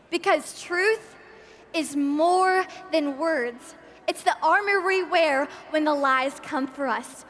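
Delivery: ordinary delivery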